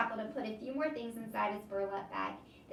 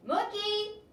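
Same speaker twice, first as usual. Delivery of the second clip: high, louder call of Mookie; a little louder; 13 semitones higher, 6 dB louder than usual. Acted. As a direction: try to wake the sleeping man. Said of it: "I said a little louder".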